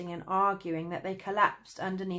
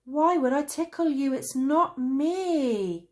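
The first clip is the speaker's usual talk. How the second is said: angry yelling; 8 semitones higher, 8 dB louder than usual. A narrator's reading. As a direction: yell back in protest. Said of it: "yelled Traveller Cane in reply".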